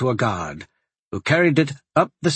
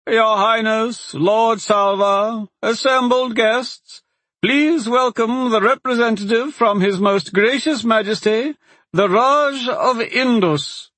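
high, slow, squeaky herald announcing; loud squeaky voice; 10 semitones higher, slower than usual. A narrator's reading. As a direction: squeak it out grandly like a pompous herald. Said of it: "In a loud and squeaky voice".

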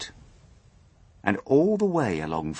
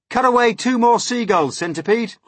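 raised, high commanding voice; shouted order; 12 semitones higher, 7 dB louder than usual. A narrator's reading. James tells Centipede to cut seagulls loose, loud order. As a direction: call the order out loudly.

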